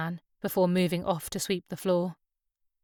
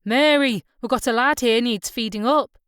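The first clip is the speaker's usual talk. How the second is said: raised voice, high and loud; shouted through; 4 semitones higher, 7 dB louder than usual. A narrator's reading. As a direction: call through to the back room. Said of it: "the man shouted through".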